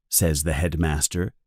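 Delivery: ordinary delivery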